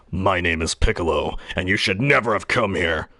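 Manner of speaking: deep anime warrior voice; low, menacing warning; Piccolo. Acted. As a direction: drop it low and ominous. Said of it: "I do a deep voice. I sound like Piccolo".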